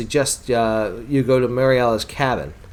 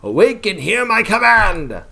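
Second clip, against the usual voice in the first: commanding wizard; 4 semitones higher, 3 dB louder than usual. Acted. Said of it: "He says".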